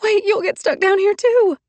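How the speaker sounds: high, loud urgent warning; alarmed protest. A narrator's reading. Trapped woman warning Hardy, high and alarmed. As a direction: stop him in alarm.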